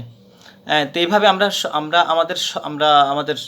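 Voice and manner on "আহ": ordinary talk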